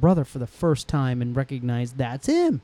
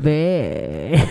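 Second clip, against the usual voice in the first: E.T. Acted. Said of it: "is asking you to stay".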